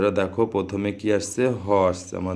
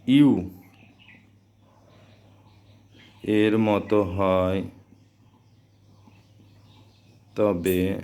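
slow, with long gaps between phrases; slow dictation; slower than usual. Shown as a demonstration. Teacher giving students a rule to write, slow dictation. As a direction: dictate it piece by piece for note-taking.

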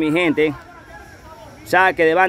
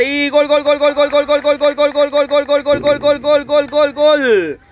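echoing stadium announcer; 8 semitones higher, 3 dB louder than usual. Real, not acted. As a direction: belt out the goal call, high and loud. Gol cantado en narración. (grito de gol prolongado y agudo)

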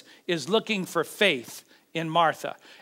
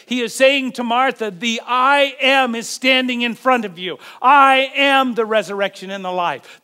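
loud, raised, ringing proclamation; full-force preaching; 5 semitones higher, 7 dB louder than usual. Real, not acted. Preacher proclaims Jesus as God on earth, full-force preaching. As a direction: proclaim it boldly, hammering each I AM.

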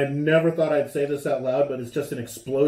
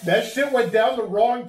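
napkin pressed to mouth; muffled by tissue at first; talking through a napkin; 7 semitones higher than usual. Real, not acted. Seen 2: Left man holds napkin to mouth and nose, drops it by 1.5.

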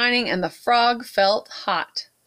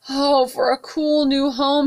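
louder, higher longing cry; 6 semitones higher, 4 dB louder than usual. Acted. Tired hot frog longs for home, louder cry. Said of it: "he cried".

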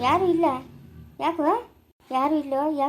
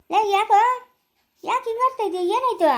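alarmed shout; 5 semitones higher, 5 dB louder than usual. Acted. Friend alarmed by blinded man.

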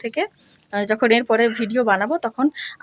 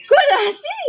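high-pitched coaxing question; high baby coo; 5 dB louder, much slower than usual. Acted. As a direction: squeak it up high to get a smile.

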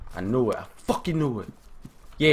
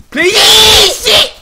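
high, loud drawn-out plea; screamed begging; panicked scream; 12 dB louder than usual. Real, not acted. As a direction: shriek it, stretching the first word. Attacked in game.

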